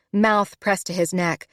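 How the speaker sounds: usual speaking voice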